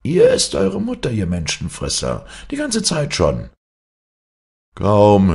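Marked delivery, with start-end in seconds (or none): 0.0-3.5 hoch und laut höhnisch rufen; spöttischer Ruf. Acted. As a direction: aus sicherer Entfernung laut verhöhnen. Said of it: "rief er". (hoher, lauter Spottruf)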